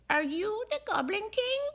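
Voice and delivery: small goblins in awe; awed goblins. A narrator's reading.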